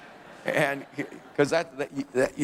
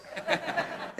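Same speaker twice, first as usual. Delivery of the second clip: short airy laugh; breathy chuckle; 7 dB quieter than usual. Real, not acted. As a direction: let a quick laugh slip out. Laughing at own joke.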